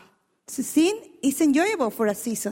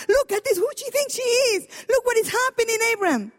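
higher, near-crying complaint; frustrated Sarah; 8 semitones higher than usual. Retold or imitated. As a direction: protest to Abraham in a high, teary voice. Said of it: "Sarah is now frustrated".

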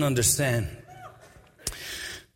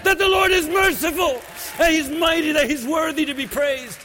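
preaching at full force; 11 semitones higher than usual. Acted. Preacher urging the church that mercy is the reason, shouting high. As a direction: build to a shouting climax.

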